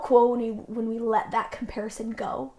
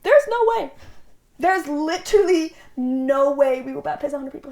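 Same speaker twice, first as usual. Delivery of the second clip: high, loud disbelief, then still raised; incredulous; 3 semitones higher, 7 dB louder than usual. Retold or imitated. Missionary balks at her president's hundred-baptism goal, incredulous. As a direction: blurt it out like the idea is absurd.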